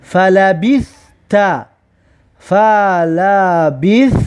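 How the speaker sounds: فلبثت drawn out very slowly; slow recitation. Shown as a demonstration. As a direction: stretch فلبثت for the class. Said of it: "میگه که".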